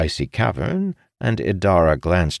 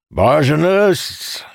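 high, drawn-out call; commanding shout; 11 semitones higher, 4 dB louder, much slower than usual. A narrator's reading. Commander calling to his magic workers, shouting. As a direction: call it out across the dock. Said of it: "Calamir shouted".

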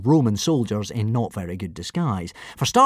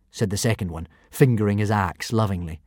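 ordinary delivery, nothing marked

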